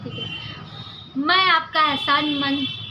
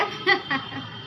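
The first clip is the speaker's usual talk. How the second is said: soft giggle; 4 dB quieter than usual. Acted. Raina laughs again.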